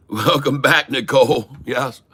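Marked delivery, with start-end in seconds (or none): none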